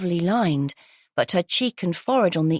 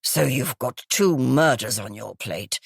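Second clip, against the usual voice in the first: gravelly, smoky voice; 5 semitones lower than usual. Acted. Groggy guest exclaiming to police in a low smoky rasp. Croaky woman character voice.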